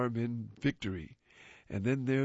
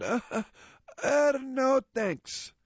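disgusted; 10 semitones higher, 3 dB louder, slower than usual. Acted. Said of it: "turns up his nose".

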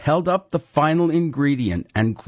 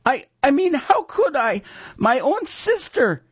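put-on high, louder scared boy; a pleading apprentice; frightened boy; 4 dB louder than usual. A narrator's reading.

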